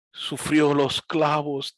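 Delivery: slow, drawn-out preachy voice; milk it like a sentimental pastor; mock preacher. Mocking pastors who preach over piano, slow and solemn. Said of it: "él dice".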